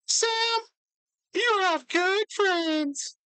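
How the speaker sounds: high, slow, drawn-out gentleman voice. Acted. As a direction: stretch it out like a pompous old gent. James speaking warmly.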